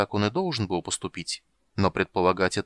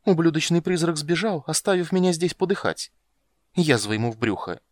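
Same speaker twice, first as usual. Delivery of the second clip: much higher, louder furious curse; angry cursing; 9 semitones higher, 4 dB louder than usual. A narrator's reading. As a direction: curse him with fury. Abandoned warrior curses the ghost.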